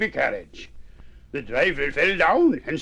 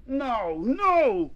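rising, higher-pitched protest; mild protest; 9 semitones higher than usual. Acted. Young mouse fed up with hearing no, mildly protesting.

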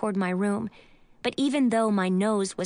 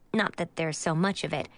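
ordinary delivery, nothing marked

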